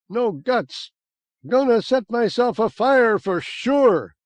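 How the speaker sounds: high, loud hysterical yell. Acted. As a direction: rant wildly while prancing about.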